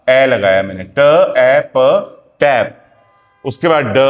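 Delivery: usual speaking voice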